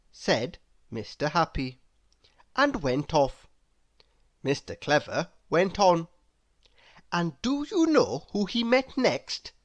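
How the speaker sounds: ordinary delivery, nothing marked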